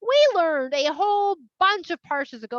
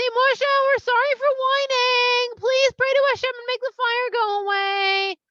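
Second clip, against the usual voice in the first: much higher, slow pleading voice; begging; 10 semitones higher, 6 dB louder than usual. Acted. People begging Moshe to stop the fire, high slow pleading. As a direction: beg Moshe like scared kids.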